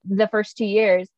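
usual speaking voice

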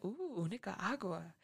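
soft slow "Ooh, Nicaragua" with interest; intrigued purr. Retold or imitated. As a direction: savour the name like a treat. She savours the answer, intrigued.

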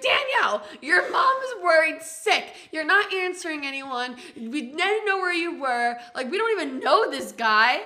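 high, loud mock telling-off; imitated frantic scolding. Friends confronting her at the door, imitated loud frantic scolding.

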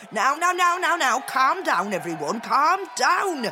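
high, loud, flustered appeal; mayor calming a mob. A narrator's reading. Mayor trying to calm angry townspeople, calling out. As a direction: call over the crowd for order. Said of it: "He tried to calm them".

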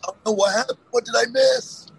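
high, worried "oh no"; alarmed. Retold or imitated. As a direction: panic a little about missing it. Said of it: "and I was like".

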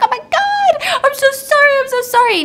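very high frantic squeal; panicked. Retold or imitated. Girl on Vespa who nearly hit him, panicked. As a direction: apologize in a mock freak-out. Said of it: "she's panicked. She's freaking out".

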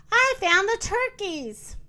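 high, excited rooster announcement; shouted. A narrator's reading. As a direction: call out the big discovery. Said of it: "he shouted".

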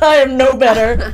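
loud, laughing through the words; laughing admission. Real, not acted. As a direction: repeat it while cracking up. Repeats admission while cracking up.

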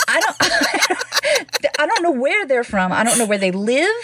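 talking through giggles; real laughing. Real, not acted. Cracking up over the actors' bad accents, laughing. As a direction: crack up while trying to talk.

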